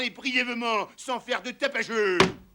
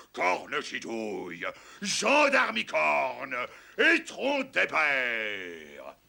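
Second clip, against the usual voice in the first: normal talking voice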